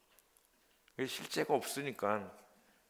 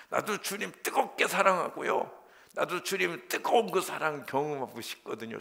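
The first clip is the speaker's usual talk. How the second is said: louder, higher, urgent plea to God; rising fervent pleading; pleading cry; 6 semitones higher, 7 dB louder than usual. Retold or imitated. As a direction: cry it out toward heaven. Retold prayer rising to a cry.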